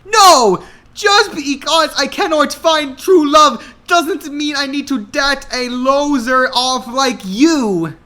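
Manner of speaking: high, loud furious scream; hammed-up shrieking rejection. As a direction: scream him away in disgust.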